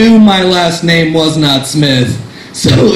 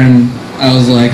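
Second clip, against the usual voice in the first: normal talking voice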